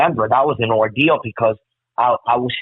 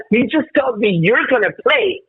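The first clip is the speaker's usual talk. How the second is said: giddy excitement; high amazed retelling; 11 semitones higher than usual. Retold or imitated. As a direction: pitch jumps way up in disbelief.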